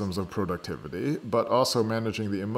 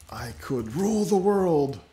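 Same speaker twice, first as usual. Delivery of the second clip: mad scientist; 9 semitones higher, 8 dB louder than usual. Pretend. Grandiose villain joke.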